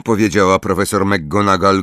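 usual speaking voice

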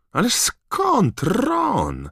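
high, slow sarcastic reply; mock his brother; ironic. Fred answers Ron sarcastically, high ironic drawl. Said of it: "ironiczny głos Freda".

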